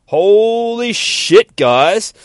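high, loud, drawn-out awe; amazed exclamation. Real, not acted. Host to listeners after the cliffhanger, amazed outburst.

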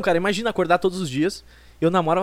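normal talking voice

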